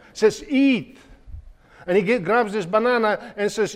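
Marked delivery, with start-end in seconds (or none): 0.5-1.0 high, friendly coaxing voice; soldier coaxing. Retold or imitated. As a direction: urge the boy kindly to eat. Soldier voiced offering food.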